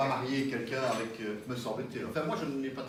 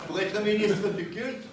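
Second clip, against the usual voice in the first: laughing; 10 semitones higher, 8 dB louder, slower than usual. Real, not acted. Laughing while explaining ministers.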